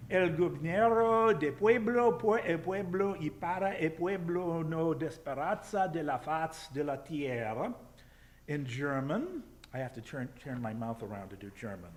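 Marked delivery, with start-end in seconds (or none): 0.1-7.7 loud, high declaimed Spanish, stretched at the start and end; stately Spanish oration; Spanish reading. Shown as a demonstration. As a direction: proclaim it in learner's Spanish. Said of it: "The Spanish, um, says".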